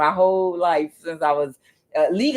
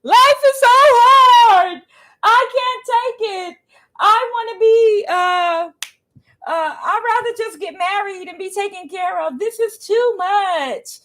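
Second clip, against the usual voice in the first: high, slow whining falsetto, hammed up; mock a young person sobbing into a car video; over-the-top high whimpering complaint; mock whiny Gen Z crying; 6 dB louder, slower than usual. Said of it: "making them car videos crying".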